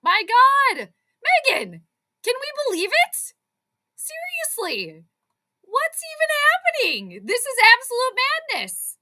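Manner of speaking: high, disbelieving fan outburst; stunned excitement. Real, not acted. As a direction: react in high-pitched disbelief, dropping at the ends. Streamer reacts to stunning game.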